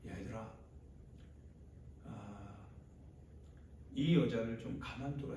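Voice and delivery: hushed start with long pauses, then firmer; a hint of a weary teacher stepping in; Jesus. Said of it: "예수님이 얘기하시는 거예요".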